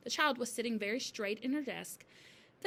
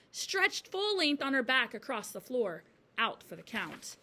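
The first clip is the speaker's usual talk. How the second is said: ordinary delivery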